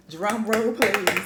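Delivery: slow, loud, drawn-out announcement; drum roll build-up. Acted. Vlogger teasing her favorite movie, slow and loud. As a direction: stretch it out for suspense. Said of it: "building up to the big reveal".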